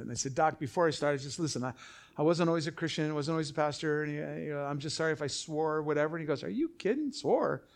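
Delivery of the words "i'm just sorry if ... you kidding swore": ordinary talk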